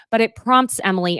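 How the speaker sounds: ordinary talk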